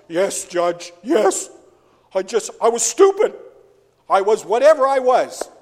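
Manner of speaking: hammed-up guilty plea; loud confession. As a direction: blurt out the confession to the judge. Confessing to the judge.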